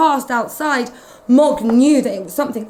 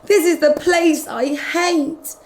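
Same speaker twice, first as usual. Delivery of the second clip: fretful cat thought; 6 semitones higher, 5 dB louder than usual. Acted. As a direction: panicky cat, knowing the worst. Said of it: "Mog thought".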